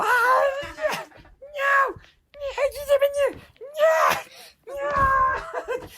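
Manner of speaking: very high shouted screams; mom's horrified screams. Acted. Mom finds the blanket attacking her girl, very high screams. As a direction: shriek in horror, again and again.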